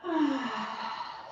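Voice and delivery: long breathy sigh out; demonstrating a relaxing sigh for the class; audible sigh. Shown as a demonstration. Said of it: "Sigh it out".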